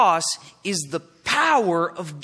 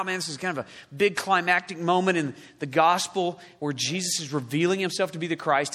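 ordinary talk, nothing marked

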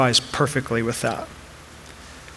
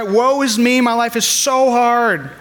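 higher, louder, slow whiny lament; mock self-pity; 8 semitones higher, 3 dB louder than usual. Pastor mocking his old complaining self, whiny. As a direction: ham up a melodramatic complaint. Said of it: "I would constantly cry".

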